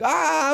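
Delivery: high mock gasp of alarm; girlfriend's startled yell, lightly imitated; retold startled cry. Courtney running in, a high startled cry. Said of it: "Courtney comes running in, is like".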